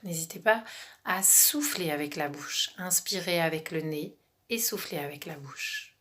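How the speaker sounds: soft exhale cue, half whispered; gentle breathing coach voice; guided meditation. Acted.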